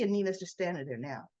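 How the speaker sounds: normal talking voice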